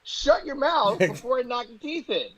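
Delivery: slow, high-pitched mock threat; retold angry threat. Retold threat to drunk fan.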